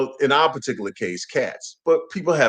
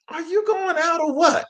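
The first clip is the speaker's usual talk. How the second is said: high mock cat voice, pitched way up; voicing the cats; 12 semitones higher, 3 dB quieter than usual. Host voicing his cats, asking if owner's leaving. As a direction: squeak it out like a fed-up cat.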